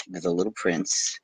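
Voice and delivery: usual speaking voice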